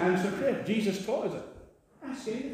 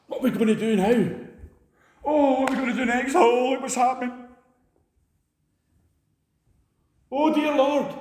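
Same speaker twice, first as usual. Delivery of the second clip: higher, fast flustered fretting king; king fretting over what to do next; panicking king; 8 semitones higher, 3 dB louder than usual. Pretend. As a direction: flap about like a king in panic. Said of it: "Imagine Solomon or King David".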